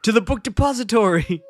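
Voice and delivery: high-pitched heroic cartoon call; rally the troops in a mock-heroic cry; Homer's rallying cry.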